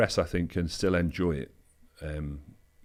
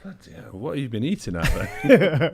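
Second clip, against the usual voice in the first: shocked wife; 7 semitones higher, 5 dB louder than usual. Retold or imitated. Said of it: "Even my missus was like".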